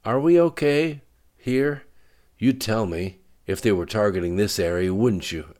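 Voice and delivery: worried. Acted. Frightened scientist asks about safety.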